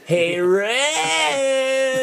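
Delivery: very high, drawn-out cartoon greeting; high cartoon voice, stretched; Stimpy. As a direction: call out like a dopey cartoon cat. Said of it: "then I can do Stimpy".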